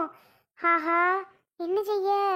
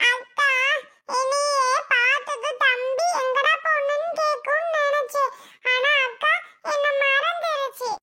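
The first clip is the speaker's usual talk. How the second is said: put-on high, slow, tearful child's voice; a small boy crying to his sister; little brother near tears, pleading; 8 semitones higher than usual. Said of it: "டேய், அழுகாதடா".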